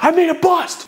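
retold yelp of a cop; mock yell. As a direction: imitate the cop's outburst in a high voice. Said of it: "You just yelled at me".

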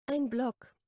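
ordinary delivery

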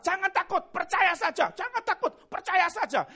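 high, loud, repeated command; forceful exhortation. Acted. Preacher voicing the Lord's command to believers, forceful. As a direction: cry it out to rally the fearful.

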